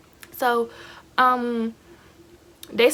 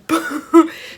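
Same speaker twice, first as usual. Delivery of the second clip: short burst of real laughter; laughing; 6 semitones higher, 9 dB louder than usual. Real, not acted. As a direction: laugh out loud, caught off guard. Laughs mid storytime.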